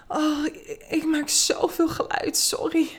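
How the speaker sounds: high, halting, out of breath; breathless with effort. A narrator's reading. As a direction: squeeze out an embarrassed apology between moans. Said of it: "brengt ze met moeite uit".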